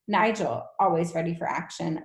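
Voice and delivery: ordinary delivery, nothing marked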